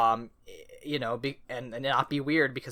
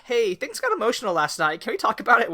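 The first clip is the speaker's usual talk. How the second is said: higher, louder, earnest friend voice; caring friend; 9 semitones higher, 5 dB louder, faster than usual. Retold or imitated. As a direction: Kipo gently asking to talk, pitched up. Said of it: "Kipo's like".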